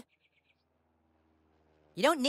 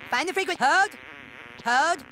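ordinary delivery

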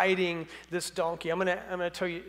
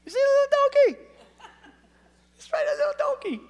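very high, squeaky ridicule; fast, comic high-pitched mockery; mocking Roman soldier; 6 dB louder, faster than usual. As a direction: tease in a tiny falsetto, half laughing. Mocking Roman ridicules small donkey.

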